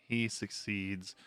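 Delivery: ordinary delivery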